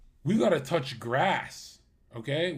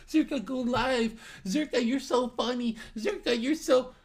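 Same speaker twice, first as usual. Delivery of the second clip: high, pleading chat voice; mock a needy fan begging him to stream; whiny fan voice; 8 semitones higher than usual. Mocking a whiny fan begging the streamer.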